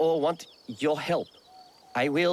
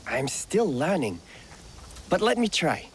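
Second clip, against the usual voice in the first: ordinary talk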